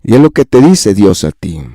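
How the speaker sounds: ordinary delivery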